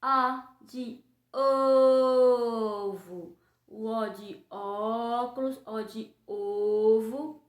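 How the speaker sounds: slow, stretched O in "ovo" and "óculos"; drawn-out O sounds. Shown as a demonstration. Teacher drawing out the O for the class. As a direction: stretch each O for little kids. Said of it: "ela tem som de O e tem som de O".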